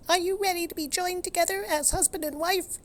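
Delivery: very high squeaking mouse priest; squeaky mouse. Acted. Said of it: "he squeaked".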